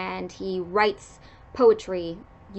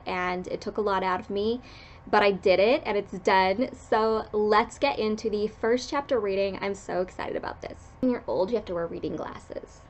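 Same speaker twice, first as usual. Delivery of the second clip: usual speaking voice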